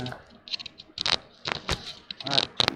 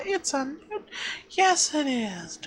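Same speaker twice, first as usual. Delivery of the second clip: whisper; 6 semitones higher, 8 dB quieter than usual. Retold or imitated. Whispered aside.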